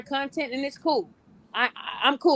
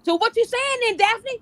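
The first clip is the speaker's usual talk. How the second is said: much higher, challenging imitation of a defensive client; mock her prickly comeback; imitating a defensive client snapping back; defensive retort; 9 semitones higher than usual. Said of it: "someone's getting defensive".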